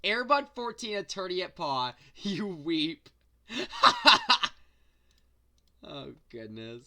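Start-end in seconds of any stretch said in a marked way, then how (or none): 3.5-4.5 laughing. Real, not acted. Bursts out laughing at joke.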